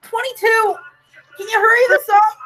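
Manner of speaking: very high put-on victim voice; high, tight, hammed-up urgency; impatient strained kid. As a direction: plead in mock discomfort to get it over with. Kid wants the act over.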